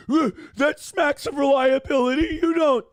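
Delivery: high, loud mocking whine; mock him in a whiny crying voice. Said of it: "he's just like".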